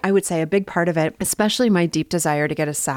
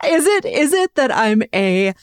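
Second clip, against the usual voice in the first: laughing; 7 semitones higher, 3 dB louder than usual. Real, not acted. Laughing reply to teasing.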